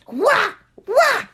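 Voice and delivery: high, short "wah" yelps; kung fu cries. Acted. Storyteller acts turtle's fight cries.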